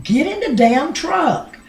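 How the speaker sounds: high, loud urgent holler. Retold or imitated. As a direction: holler it out like an order across a bridge. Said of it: "I was hollering".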